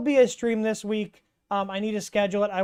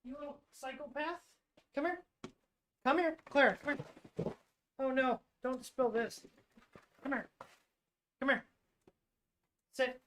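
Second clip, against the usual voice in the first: higher and much softer, calling the dog over; quiet, higher pet talk; soft coaxing to dog; 4 semitones higher, 9 dB quieter than usual. Real, not acted. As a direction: coax the dog close in a hushed pet voice.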